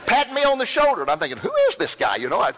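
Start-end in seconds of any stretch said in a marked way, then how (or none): 1.4-2.1 startled thought. Retold or imitated. Stranger puts arm around him.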